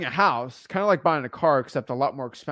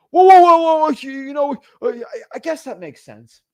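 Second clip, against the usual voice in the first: startled seller; 10 semitones higher, 10 dB louder than usual. Retold or imitated. Seller caught off guard.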